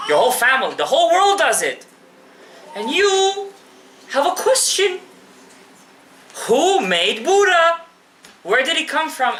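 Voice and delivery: high, rising sermon build, pleading with the crowd; a preacher lifting his voice to stir the room; impassioned preaching. Acted. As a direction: paint the picture at full preaching force. Builds the scene at full pitch.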